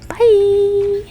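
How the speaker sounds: high, loud, drawn-out bye; sing-song sign-off. Real, not acted. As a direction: hold a high farewell note. Signing off for the day.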